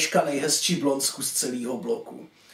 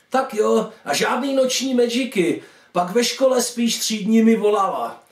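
put-on higher-pitched mum reminding her boy; mother's reminder; 5 semitones higher, 3 dB louder than usual. Mother admonishes her schoolboy son. (vyšší hlas napomínající mámy)

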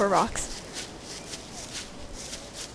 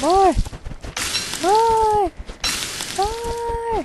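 rising shouts of More!, each higher; greedy shouting; 9 semitones higher, 6 dB louder, much slower than usual. Real, not acted. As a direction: yell More! louder and higher each time. Greedily demanding more carrots.

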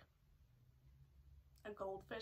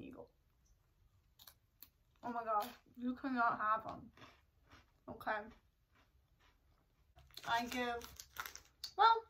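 chewing while talking; speaking around food; mouth full. Real, not acted. Seen 0: put snack in mouth at -2s, jaw working while speaking.

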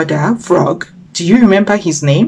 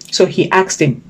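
ordinary delivery, nothing marked

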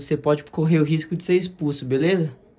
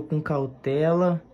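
drawn out slowly; slow, careful; slower than usual. Real, not acted. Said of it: "vou editar o vídeo aqui com cuidado".

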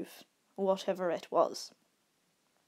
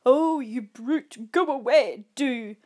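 loud, drawn-out mock scolding; mocking imitative shout; 3 semitones higher, 8 dB louder, slower than usual. A narrator's reading. Friend mocking camper's attempt at shouting, merciless imitation. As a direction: mimic a timid shouter mercilessly. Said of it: "Katz shouted in merciless imitation".

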